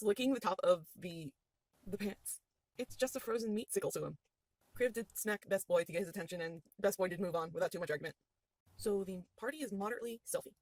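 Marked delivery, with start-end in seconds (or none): none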